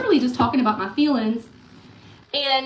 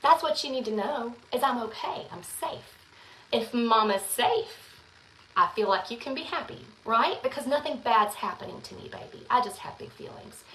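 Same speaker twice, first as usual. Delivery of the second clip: soft reassurance; 6 dB quieter than usual. Retold or imitated. Mom quietly reassuring her son she is safe. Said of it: "I told him".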